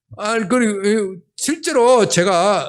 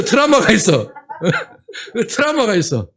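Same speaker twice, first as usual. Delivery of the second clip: 트라우마가 said through laughter, louder; laughing repeat; 3 semitones higher, 4 dB louder than usual. Real, not acted. Preacher laughing at his own kitchen trauma.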